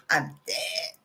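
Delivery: breathy mock whisper, trailing off. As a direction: let it fade to a whisper. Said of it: "They were like".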